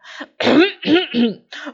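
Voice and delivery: loud, theatrical throat-clearing. Acted. Wolf about to address the sheep, clearing his throat.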